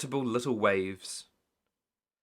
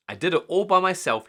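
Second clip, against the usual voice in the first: proudly; 7 semitones higher, 6 dB louder, faster than usual. A narrator's reading. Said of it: "he said proudly".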